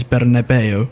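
Bernabeu as "behr-neh-BAY-oo"; Spanish-style Bernabeu, ending "BAY-oo"; Spanish pronunciation. Shown as a demonstration. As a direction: sound every letter in Bernabeu, "behr-na-BAY-oo". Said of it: "you pronounce every letter".

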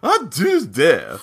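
hammed-up indignant scolding, pitch leaping up; mock outrage. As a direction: play at being deeply offended. Mock outrage at co-host's remark.

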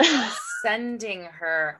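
drawn out very slowly, the words stretched; slow realization. Retold or imitated. Reader recalling the moment she understood, very slow drawl. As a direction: let it dawn on you word by word. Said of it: "I literally was like".

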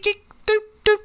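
high, light mock tapping sounds; pecking noises. Mimicking slow phone pecking.

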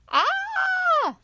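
sudden high scream. Acted. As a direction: shriek in fright at the door. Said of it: "let out a scream".

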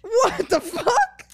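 high, loud, slow disbelieving what; stunned. Real, not acted. Reacting in disbelief to a dramatic quote. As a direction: drag it out in shock. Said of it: "reacting to a dramatic quote".

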